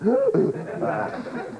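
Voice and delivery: put-on an old man's rattly gasp for air; mock wheezing old man. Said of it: "his chest starts going".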